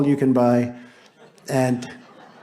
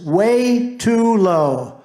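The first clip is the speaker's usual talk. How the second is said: mock drawn-out quote; 4 semitones higher, 4 dB louder, much slower than usual. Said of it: "quote, her words".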